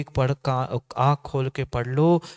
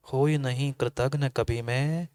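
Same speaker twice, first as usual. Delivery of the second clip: recited verse; 4 dB quieter than usual. Acted. Preacher softly recites a devotional verse, chanted. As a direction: recite it gently, like a prayer.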